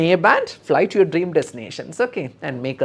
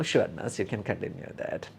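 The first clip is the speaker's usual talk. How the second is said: patient consents; 6 semitones lower, 8 dB quieter than usual. Shown as a demonstration. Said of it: "the patient will be saying like".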